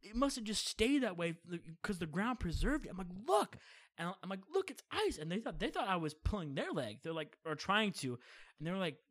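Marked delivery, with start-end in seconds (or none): 3.3-3.6 high, loud excited cry; insistent excitement. Retold or imitated. Showing parents the find, insistent and excited. 4.5-5.2 high-pitched, amazed insistence; insistent excitement. Retold or imitated. Kid showing doubting parents his find, excited.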